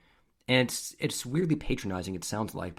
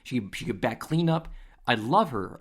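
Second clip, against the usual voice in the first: higher, louder gushing praise; imitating delighted scout; 4 semitones higher, 3 dB louder than usual. Scout raving about a batter to her dad, acted-out excitement. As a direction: play a scout bowled over by a player. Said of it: "the scout is loving it".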